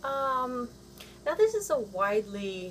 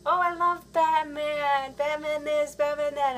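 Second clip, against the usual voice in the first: gushing fan; 7 semitones higher, 5 dB louder than usual. Retold or imitated. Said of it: "everybody's like".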